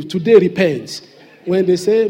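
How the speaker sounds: ordinary talk, nothing marked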